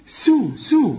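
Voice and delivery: سو with a light, thin س; light seen. Shown as a demonstration. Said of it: "السين سو يا راجل".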